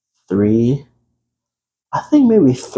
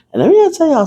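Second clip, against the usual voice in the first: much higher, fast hype; much higher quick teaser; excited; 4 dB louder, faster than usual. Acted. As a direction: rush it out with excitement. Excited lead-in.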